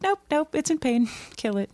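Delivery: lightly imitated offhand dismissal, starting high; mock nonchalant. Character overruling the kids about killing it, mock nonchalant. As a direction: brush it off, pitch stepping down. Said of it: "the nonchalant and normalized way that she deals".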